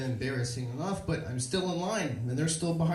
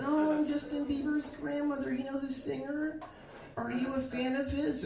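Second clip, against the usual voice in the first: put-on high, soft old-lady voice; little old lady; 11 semitones higher, 4 dB quieter than usual. Old lady introduces herself to bar patrons, high soft voice. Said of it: "there was this little old lady".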